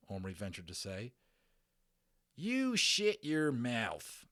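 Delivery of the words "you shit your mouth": very slow, loud, high Texas yell; cowboy shout. Acted. Tiny cowboy shouting down the boy in anger. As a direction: spit it out at him, furious. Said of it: "shouted the little man".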